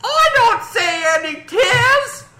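very high, slow put-on voice; shrill comic peasant; squeaky villager voice.

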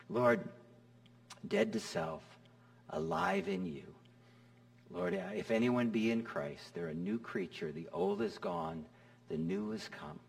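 soft, hushed personal prayer; quiet prayer. Pretend. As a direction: pray it softly under your breath.

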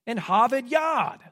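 "Harvard Yard" with dropped r sounds, "Hahvahd Yahd"; a playful Boston accent on "Harvard Yard". Shown as a demonstration. Pastor contrasting regional accents, light Boston accent. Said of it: "we can tell where some of our church members have come from by their accent".

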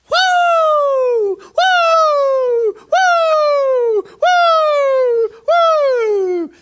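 high, loud "woo" over and over; repeated piercing screams. Retold or imitated. Lady running around the church, repeated screaming. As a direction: shriek long woos like a siren. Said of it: "this scream getting louder".

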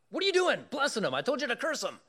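high, loud exasperated king; angry king. Retold or imitated. King scolding Balaam for blessing, high and loud. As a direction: scold the prophet sharply.

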